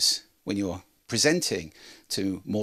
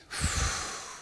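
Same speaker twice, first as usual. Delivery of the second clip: long audible breath; demonstrated deep breath; 6 dB quieter than usual. Shown as a demonstration. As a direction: breathe slowly and audibly to show the exercise. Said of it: "In through the mouth".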